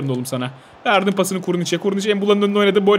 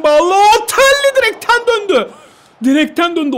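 high, loud, slow cry at the post; shocked cry; 11 semitones higher, 6 dB louder than usual. Real, not acted. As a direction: gasp it out in disbelief. Shot hits the post.

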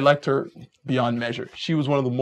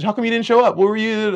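upset date; 7 semitones higher, 4 dB louder than usual. Retold or imitated.

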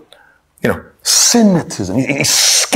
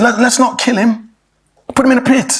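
higher-pitched, urgent pleading; a touch of panic, pitched up; mildly panicked; 10 semitones higher, faster than usual. Acted. Reuben pleads with his brothers over Joseph, mildly panicked. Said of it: "he was panicking about the situation".

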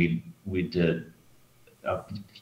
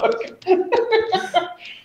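high giggle; trailing giggle fit; high laughter; 3 dB louder than usual. Real, not acted. Caught, laughing.

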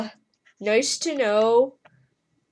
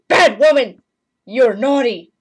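loud playful telling-off; hammed-up loud scolding; mock scolding shout; 2 semitones higher, 9 dB louder than usual. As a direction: yell it like a teasing scold.

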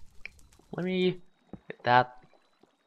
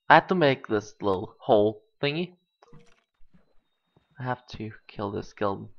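usual speaking voice

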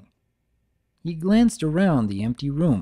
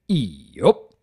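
Big Mac yup; 5 dB louder than usual. Acted.

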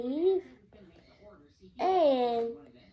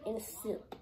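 whispering; 3 semitones lower, 10 dB quieter than usual. Real, not acted.

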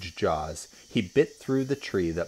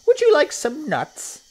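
squeaky-high, loud monkey offer; Mugglewump; 10 dB louder than usual. A narrator's reading. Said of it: "asked Mugglewump".

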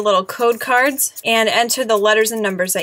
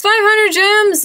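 much higher, slow delighted announcing; excited cry; 10 semitones higher, 4 dB louder, slower than usual. Real, not acted. Vlogger announcing another gem prize, excited. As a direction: savor the prize, thrilled.

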